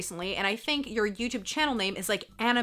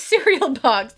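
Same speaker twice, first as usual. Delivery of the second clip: usual speaking voice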